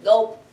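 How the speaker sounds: loud exaggerated gulping sound. A narrator's reading. Boy finally swallows the pea, gulping loudly. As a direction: make a big swallow noise.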